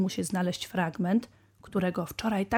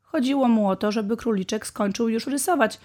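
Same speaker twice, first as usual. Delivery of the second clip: usual speaking voice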